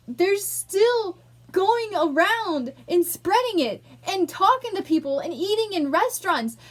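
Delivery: building from heated complaint to loud, high shouting; real furious yelling, exploding at the end; furious outburst. Real, not acted. Venting at family spreading the disease, furious. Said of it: "Dang, I'm mad".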